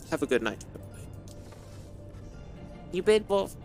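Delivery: usual speaking voice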